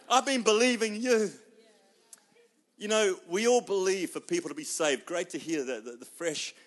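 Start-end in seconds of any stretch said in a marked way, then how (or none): 0.1-1.3 higher, louder incredulous plea to God; half-laughing twenty minutes; desperate disbelief. Retold or imitated. Pastor voicing a desperate member, incredulous plea.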